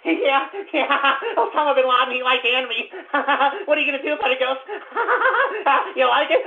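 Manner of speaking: high, cackling imitation of excited fans; mocking giggly fans. Host imitates gloating anime fans taunting him, laughing mockingly. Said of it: "They're like".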